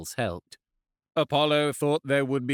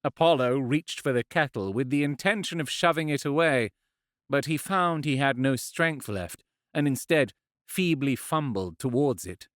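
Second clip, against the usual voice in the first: plain reading voice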